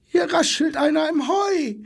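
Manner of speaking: high-pitched alarmed call; zebra's cry. A narrator's reading. Zebra alarmed by rustling in the hay, crying out high. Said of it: "schrie in diesem Augenblick das Zebra".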